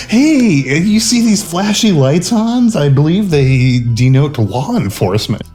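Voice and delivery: high, eager nerdy voice; mock Corbin. To Hans, a high eager nerd voice. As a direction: pipe up like an excited nerd. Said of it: "Corbin's all like".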